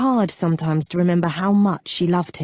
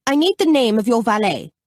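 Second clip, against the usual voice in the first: higher, raised-voice demand; calling out; 6 semitones higher, 4 dB louder than usual. A narrator's reading. Freddie calling out to the departing Baron, raised voice. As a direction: call after him across a hallway. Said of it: "Freddie called out to the Baron".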